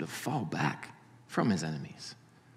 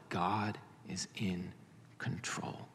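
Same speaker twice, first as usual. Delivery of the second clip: hushed whisper; 4 semitones lower, 12 dB quieter, slower than usual. Shown as a demonstration. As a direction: whisper it, much softer, slowly. Preacher's hushed emphasis.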